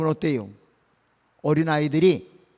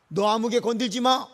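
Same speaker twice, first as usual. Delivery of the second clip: raised-pitch protective warning; 8 semitones higher than usual. Acted. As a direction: warn them off your child. God warns off his child's attackers.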